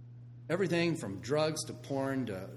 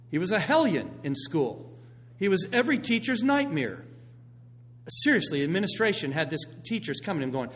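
ordinary talk

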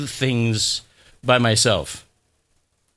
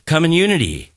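"Come in unity" run together quickly; quick demo for the congregation to repeat; even faster; 2 semitones higher, 3 dB louder than usual. Shown as a demonstration. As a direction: say "Come in unity" faster, words nearly merging. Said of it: "Say it even faster".

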